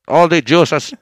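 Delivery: loud mock Mexican-accented Hitler speech. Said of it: "Sounds so much like Mexican Hitler".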